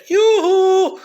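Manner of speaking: high, loud falsetto yoo-hoo; sing-song taunting call, way up high; shrill cartoonish yoo-hoo; mocking yoo-hoo.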